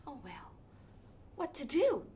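plain reading voice

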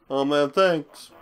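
slow, drawled Texan thank-you; lazy laid-back Southern drawl; Matthew McConaughey impression. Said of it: "There's Matthew McConaughey again".

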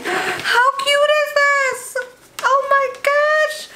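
high, slow squealing delight. Real, not acted. Vlogger unboxing a cute gift, squealing with delight. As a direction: squeal over how cute it is. Gasps opening cute package.

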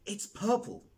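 high, loud shocked exclamation; shocked cry. Acted. Boy discovers his purple bottom, shocked high cry. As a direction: cry out in horror at what you see.

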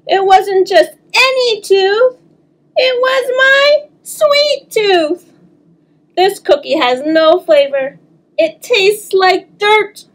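high, slow whimpering complaint; whiny lament. Acted. Child laments lost sweet tooth.

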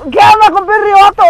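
grito agudo y fuerte de retador; reto a gritos. Retold or imitated. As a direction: pide otra ronda a gritos, actuado. Repite el reto de pelea. (desafío gritado en plena pelea recreada)

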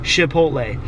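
Chipotle with an sh at the front. Retold or imitated. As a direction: begin Chipotle as if shushing someone. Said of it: "make the C H sound like sh".

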